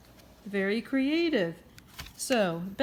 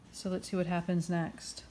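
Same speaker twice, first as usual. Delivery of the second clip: ordinary talk, nothing marked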